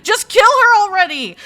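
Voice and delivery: loud, high exasperated rant; shrill-free loud outburst at a character. Real, not acted. Ranting at a hated cat.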